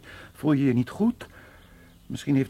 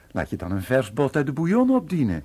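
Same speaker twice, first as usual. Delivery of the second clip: ordinary talk, nothing marked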